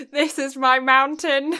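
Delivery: loud proclaiming fantasy hero; grandiose elf. Pretend. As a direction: declare it like a hammy fantasy hero. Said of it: "I always feel like I'm larping".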